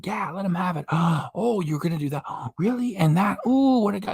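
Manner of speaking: high, gasping mock awe from gullible fans. Mocking her fans, high gushing mock awe. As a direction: gush in fake amazement like a gullible fan. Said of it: "believe her are like".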